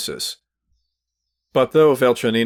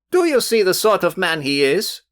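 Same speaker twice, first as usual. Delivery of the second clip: higher, louder outburst; cried out; 9 semitones higher, 4 dB louder than usual. A narrator's reading. The youth cries out to Velchaninov, hot and loud. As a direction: burst out hotly at the rival. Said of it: "the youth cried at once".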